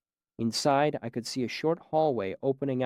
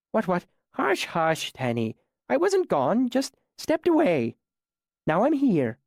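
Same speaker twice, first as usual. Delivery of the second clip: put-on a higher, patient old man soothing a child; gentle old man; 8 semitones higher, 4 dB louder than usual. A narrator's reading. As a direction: hush the frightened boy like a mother cat. Old man soothes tied boy.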